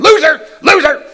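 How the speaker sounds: high, loud jeering shout of Loser; mocking yell. Drivers on the freeway jeering at him, loud mocking yell. Drivers honking, imagined taunts.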